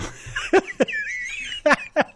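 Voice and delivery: very high squeal of a laugh; squeaky laugh. Acted. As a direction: let a squeaky giggle escape. Laughing at the botched joke.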